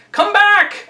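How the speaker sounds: high, emphatic call. A narrator's reading. Monkey calling his severed head to return, high emphatic call. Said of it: "in a voice from his throat, deep down".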